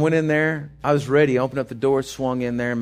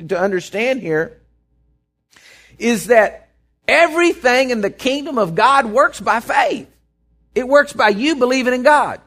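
higher and louder, slowing on key words; full-force preaching; 8 semitones higher, 5 dB louder than usual. Acted. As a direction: hammer home the point about faith.

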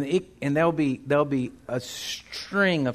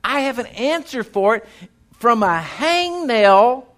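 rising, louder preaching build; full-force preaching; 7 semitones higher, 5 dB louder than usual. Acted. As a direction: drive it home to the congregation.

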